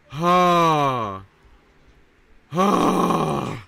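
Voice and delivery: loud high sustained ah yells; game yell. Real, not acted. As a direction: shout the vowel to make the character climb. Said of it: "do I have to yell louder to get higher".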